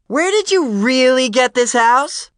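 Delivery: slow, very high, loud screech; screeching question. A narrator's reading. Jane to John from across the party, screeching. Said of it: "voice screeching across the room".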